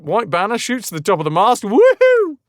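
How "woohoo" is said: very high, loud triumphant whoop; excited whoop. Real, not acted. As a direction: cheer the victory. Capture of enemy ship.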